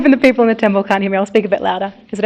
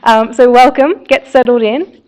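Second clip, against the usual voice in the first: projected, louder welcome to the room; a bit louder; 3 semitones higher, 9 dB louder than usual. Real, not acted. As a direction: speak up so the back can hear. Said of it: "a little bit louder?".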